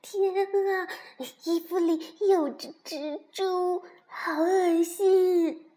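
high, drawn-out frightened squeal; scared and disgusted. A narrator's reading. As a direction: recoil from a creepy-crawly. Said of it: "蹦蹦害怕得直发抖". (害怕又恶心地惊叫)

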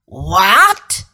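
furious, loud outburst; roaring witch. A narrator's reading. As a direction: roar it like an enraged queen. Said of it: "roared the witch".

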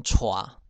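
歘 as "tshuà", aspirated with a wa glide; Hokkien reading. Shown as a demonstration. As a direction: say 歘 as Hokkien "tshua". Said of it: "念成歘".

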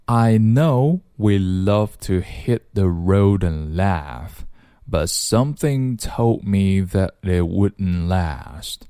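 slow, measured rap lyric reading; unhurried practice run for learners; slow read-through. Shown as a demonstration. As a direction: read the whole verse slowly, linking the words. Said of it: "来试着读一下".

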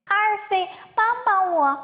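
a little rabbit calling to a friend, lightly voiced; storybook cry for help. A narrator's reading. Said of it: "小兔子喊道".

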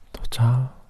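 usual speaking voice

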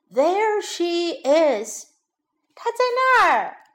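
high, loud, slow shout of discovery; shouting together. Acted. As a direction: yell it out, pointing at the intruder. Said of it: "他们一起大叫".

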